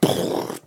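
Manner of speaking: breathy mouth-made blast; imitated explosion. Teller imitates a building blowing up, explosive. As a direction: puff out an explosion noise.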